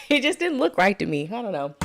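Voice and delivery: ordinary delivery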